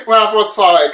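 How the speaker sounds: ordinary talk, nothing marked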